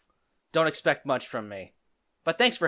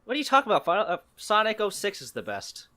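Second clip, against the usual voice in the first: mock outrage; 8 semitones higher than usual. Mock outrage defending Sonic 06.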